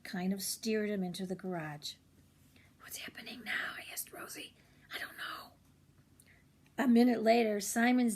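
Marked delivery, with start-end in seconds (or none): 2.8-5.5 unvoiced spying whisper; whispering kids. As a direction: whisper from behind the trees. Children whisper while spying.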